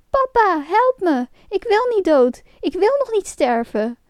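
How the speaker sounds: high-pitched desperate begging; pleading cry. A narrator's reading. The poor puppet begs father to save him, pleading. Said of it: "begon te huilen en riep smekend".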